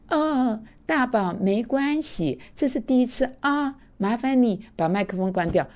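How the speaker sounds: mock gentle coaxing; overly tender coaxing. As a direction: coo it like soothing a baby. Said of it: "No, no, no, no, no，我不会的".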